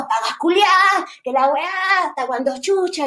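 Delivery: furious shout. Retold or imitated. Mom cursing the police while throwing her heels, a mock furious yell. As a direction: hurl the insults like throwing the heels.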